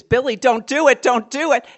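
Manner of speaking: loud high frantic pleading. Retold or imitated. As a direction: beg him not to light it. Girl begging brother to stop.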